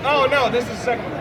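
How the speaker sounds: ordinary delivery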